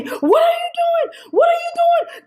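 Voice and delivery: high, strained shouting at her, pitched far up; officer screaming. Retold or imitated. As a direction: yell it like a cop panicking over open windows. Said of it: "screaming at me".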